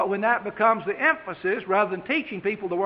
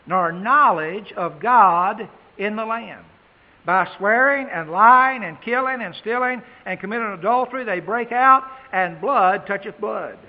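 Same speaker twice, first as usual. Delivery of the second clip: scripture read with force; 4 dB louder than usual. Acted. Preacher reading Hosea's prophecy to congregation, loud and solemn. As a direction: proclaim each line like judgement.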